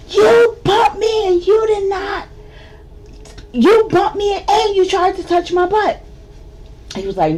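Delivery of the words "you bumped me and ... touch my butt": loud, high-pitched accusing shout. Retold or imitated. Feisty shouting the accusation at the stranger. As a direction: yell it at him, outraged.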